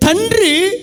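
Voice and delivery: ordinary talk, nothing marked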